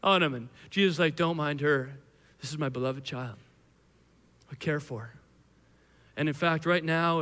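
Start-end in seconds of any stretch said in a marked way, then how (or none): 1.2-5.2 low, soft, tender voice; gentle reassurance. Acted. Jesus defending his beloved child, gentle and low. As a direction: speak softly to shelter her.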